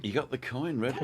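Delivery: ordinary talk, nothing marked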